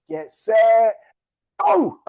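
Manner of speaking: high, loud shout of go; starting call. Real, not acted. Host sending racers off, loud high shout. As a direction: fire them off the line.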